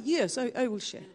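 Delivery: put-on softly spoken Russian accent. Russian churchgoer agrees to share a testimony, imitated Russian accent. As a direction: imitate a Russian man speaking English. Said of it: "try and do a Russian accent".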